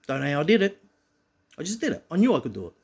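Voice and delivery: usual speaking voice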